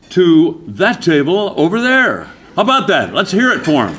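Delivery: tongue-in-cheek big-top presenter; mock fanfare. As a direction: announce a table move like a circus act. Mock-grand announcement of a trivial show.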